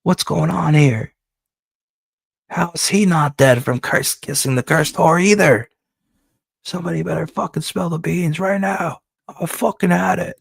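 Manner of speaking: Jumpin' Joey loses it at his henchmen, raging; pissed off. Acted. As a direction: blow up at everyone in the room. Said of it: "Jumpin' Joey's all pissed".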